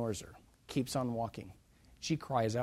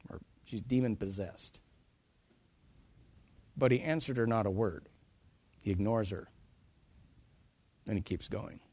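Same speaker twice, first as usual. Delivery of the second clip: ordinary talk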